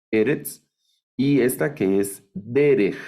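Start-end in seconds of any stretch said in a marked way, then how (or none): 2.3-3.0 Derech said DE-rej, first syllable stressed. Shown as a demonstration. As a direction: hit the DE of Derech. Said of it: "esa es la forma de leerlo". (Derech acentuado en la primera sílaba)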